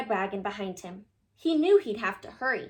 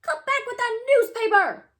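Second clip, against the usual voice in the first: loud high yell; 12 semitones higher, 8 dB louder than usual. Acted. Paper boy yelling after the rabbits, loud. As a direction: stop the thief with a shout. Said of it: "yelled the boy".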